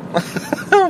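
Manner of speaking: squeaky rising giggle; high giggle. Real, not acted. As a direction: giggle helplessly. Keeps laughing.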